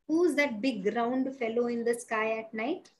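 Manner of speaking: plain reading voice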